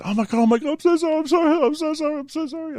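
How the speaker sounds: very high, gabbled imitation of a terrified driver; hammed-up frightened pleading; mock panicked apology. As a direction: squeal apologies as if scared stiff, fading off. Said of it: "She was shitting herself".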